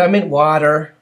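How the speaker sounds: mock American pronunciation of "water"; imitated American accent. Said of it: "it's an accent thing".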